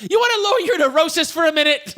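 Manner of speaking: high-pitched mock yell; imitating yelled argument. Quoting the movie couple fighting in the street, mock yelling. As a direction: act out an exasperated movie quarrel. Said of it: "so they're yelling at each other".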